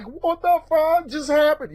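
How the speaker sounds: high, stunned blurt at dad; shocked outburst. Retold or imitated. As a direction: retell the shock like it's hitting you again. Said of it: "being like".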